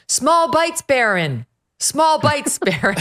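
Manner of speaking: imitating Melania. Host quotes mother telling son at dinner, high singsong imitation. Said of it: "We, we quote her all the time".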